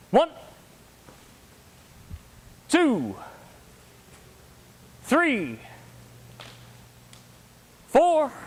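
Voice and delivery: high counts with long pauses between; drill count call. Real, not acted. Instructor taking the class through by the numbers, drill count call. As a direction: call out each number as a separate command. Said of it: "one more time by the numbers".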